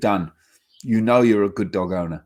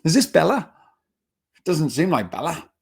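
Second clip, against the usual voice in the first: higher, astonished questions; amazed neighbours; 6 semitones higher, 3 dB louder than usual. Retold or imitated. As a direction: play the stunned friend who barely recognizes the dog. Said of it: "people actually start saying".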